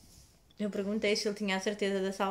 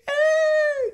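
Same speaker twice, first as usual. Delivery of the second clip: much higher and louder crying wail; loud happy wail; 13 dB louder than usual. Retold or imitated. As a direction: let out a sob of relief. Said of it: "comecei a chorar".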